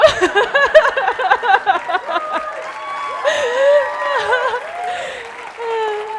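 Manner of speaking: loud, high joyful laughter; long laugh. Real, not acted. In the red zone before the audience, joyous laugh. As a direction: laugh with delight.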